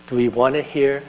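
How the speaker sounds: ordinary talk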